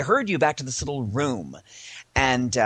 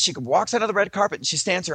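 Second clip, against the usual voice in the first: normal talking voice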